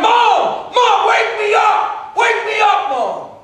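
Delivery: high-pitched cry out to mom; hollering. Acted. Dreamer begging his mother to wake him, hollering. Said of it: "He starts hollering".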